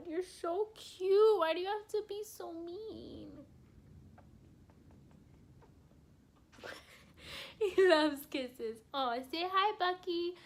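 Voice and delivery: high, slow cooing to the dog. Real, not acted. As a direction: coo over your puppy. Cooing at her puppy on camera.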